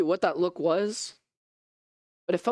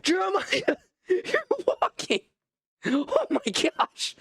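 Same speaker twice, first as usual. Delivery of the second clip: much higher, laughing and tearful with joy; overjoyed; 14 semitones higher, much slower than usual. Acted. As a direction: burst out in slow, laughing disbelief. Sees healed friend walking.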